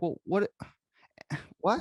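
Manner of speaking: high confused sigh; mock bewildered what; baffled. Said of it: "And it's like".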